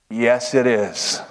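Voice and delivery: very slow, low, savoured yes; smitten lie. Acted. Man claims Jennifer Lopez as his wife, smitten. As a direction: draw it out low and pleased.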